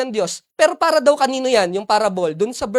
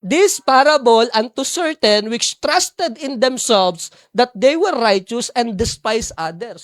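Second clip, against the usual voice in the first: scripture reading; 4 dB louder, slower than usual. Shown as a demonstration. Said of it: "Ayan, no?".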